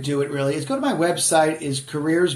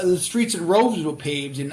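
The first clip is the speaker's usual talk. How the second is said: ordinary talk, nothing marked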